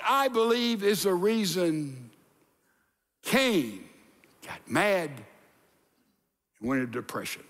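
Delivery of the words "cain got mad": ordinary delivery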